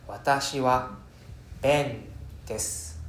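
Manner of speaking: Watashi wa, a pause, then ben desu slowly; pause after wa. Shown as a demonstration. Teacher shows the pause after particles. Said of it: "I do a pause after the wa".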